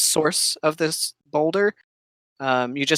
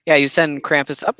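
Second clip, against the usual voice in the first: ordinary talk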